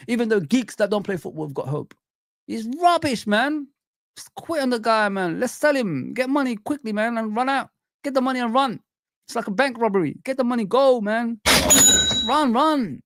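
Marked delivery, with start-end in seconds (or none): 2.5-13.0 much higher pitch, fed up; exasperated outburst. Real, not acted. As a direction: pitch it up like a fan who's had enough. Fan giving up on a player.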